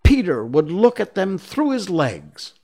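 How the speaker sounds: put-on high, loud boyish cry. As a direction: exclaim it like boys hit by an idea. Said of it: "they cried".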